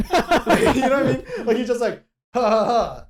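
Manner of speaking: laughing while talking; cracking up. Real, not acted. Laughing at the scene.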